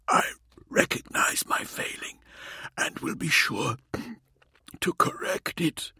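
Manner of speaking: whispered, pained moan. A narrator's reading. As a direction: moan it out weakly, broken. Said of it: "he moaned".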